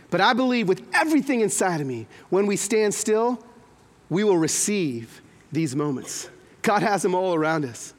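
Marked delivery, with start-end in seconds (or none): none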